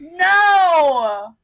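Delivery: loud anguished cry; shout. Real, not acted. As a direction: yell it out in horror. Character dying.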